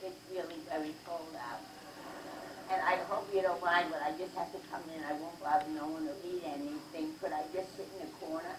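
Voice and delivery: small, soft snail voice begging to come in; a tiny creature pleading at the door. Acted.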